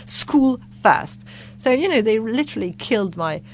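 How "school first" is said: loud, stern parental no; mock stern parents forbidding it; strict parents. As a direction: lay down the law like a strict parent. Parents voiced refusing acting career.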